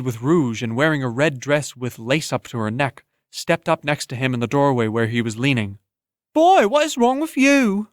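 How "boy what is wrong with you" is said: high, loud tavern woman voice; a brassy woman demanding what's wrong with him; sharp tavern woman. Acted. Tavern woman snaps at a stumbling boy, high and loud.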